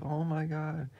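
fast, excited hero voice; Papadato voice. Acted. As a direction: hero stunned that his friend returned.

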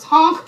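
sudden loud goose honk. Pretend. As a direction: blast a silly honk. Pretending to be a goose.

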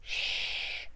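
soft breathy hush; gentle shush. A narrator's reading. Mum gently hushing her daughter. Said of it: "Mummy said gently".

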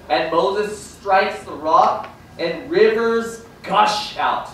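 slowed, weighty storytelling; dramatic slow telling. Acted. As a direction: draw it out like a grand scene. Dramatic retelling of Moses.

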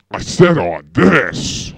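insistent. Acted. Host insists, repeating himself.

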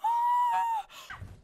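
high, drawn-out gasp; startled gasp. Real, not acted. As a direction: gasp sharply into a high cry. Startled in the game.

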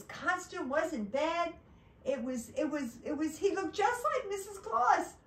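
high-pitched, stammering excitement; excited build-up. A narrator's reading. Building up disguise before letdown.

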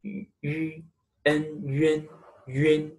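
yun built from yu and en; pinyin yun demo. Shown as a demonstration. Teacher shows students how yun is said. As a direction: blend yu into en: yun. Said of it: "It pronounce".

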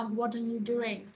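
slow "what are you doing" with "are" as "uh"; reduced vowel. Shown as a demonstration. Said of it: "nó sẽ đọc thành ơ". (đọc chậm "what are you doing", "are" thành "ơ")

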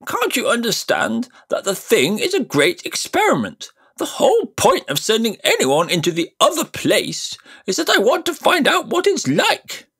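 put-on high, loud, impatient old uncle; impatient Uncle Andrew. Uncle scolding his nephew, high and impatient. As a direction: lecture the boy impatiently from up high. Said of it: "said Uncle Andrew with a look of impatience".

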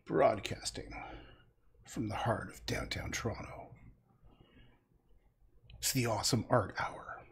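a soft, half-whispered announcer read of the show's name; hushed show intro. Acted. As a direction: open the stream like a secret late-night broadcast.

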